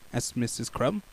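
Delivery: plain reading voice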